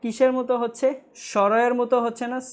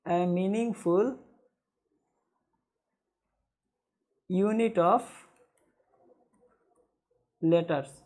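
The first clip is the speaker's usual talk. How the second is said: very slow, lower and softer, with long pauses; slow dictation; 4 semitones lower, 6 dB quieter, much slower than usual. Shown as a demonstration. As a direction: dictate piece by piece, leaving time to write. Teacher dictating a definition in pieces.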